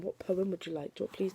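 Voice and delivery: plain reading voice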